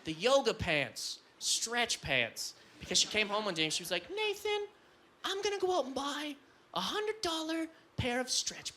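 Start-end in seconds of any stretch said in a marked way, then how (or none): 4.1-8.8 put-on high, soft girl voice. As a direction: squeak it like his girlfriend. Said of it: "when I do that girl voice".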